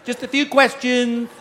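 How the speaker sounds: high, sweet mock receptionist, slowing on the choice; perky counter girl. Kennel clerk asking the comic about his dogs, lightly mocked high voice. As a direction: chirp the questions like a spa clerk. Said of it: "a little girl behind the counter goes".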